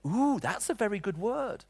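high, slow, drawn-out ooh of delight; imitating the hairdresser's pleased surprise. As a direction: savour the word like a treat. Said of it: "She said".

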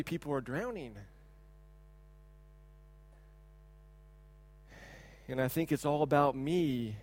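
low, soft, with heavy pauses; quiet confession. Real, not acted. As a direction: own up to it in a hush. Confesses his own selfishness.

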